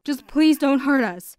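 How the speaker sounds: father begging the robbers not to hurt them; desperate pleading. Acted. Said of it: "he pleaded".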